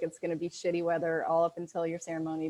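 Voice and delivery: ordinary delivery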